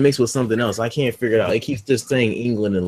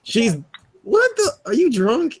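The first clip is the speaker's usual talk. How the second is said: can't believe what he just heard; shocked disbelief; 13 semitones higher, slower than usual. Real, not acted. To his friend who ignores her race, shocked disbelief. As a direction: pitch jumps way up in disbelief.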